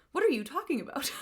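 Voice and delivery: sudden high surprised question; startled what. Acted. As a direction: snap back in disbelief. Husband's startled question, acted.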